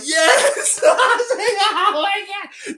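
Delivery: high, loud cheer through laughter; delighted laughing outburst. Retold or imitated. Retelling delight at the kid's favorite artist, laughing outburst. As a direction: shriek with glee, cracking up.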